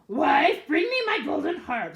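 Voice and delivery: high, loud giant calling his wife; ogre calling out. Acted. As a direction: call for the harp like a demanding giant. Ogre calls out.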